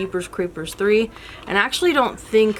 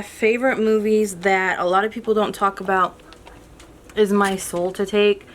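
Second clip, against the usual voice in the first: ordinary delivery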